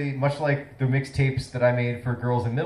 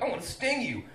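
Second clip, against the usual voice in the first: lightly voiced jellyfish, higher and softer; mock jellyfish voice; 9 semitones higher, 6 dB quieter than usual. Comic impersonates brainless floating jellyfish, mock high little voice. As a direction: squeak it softly as a silly jellyfish.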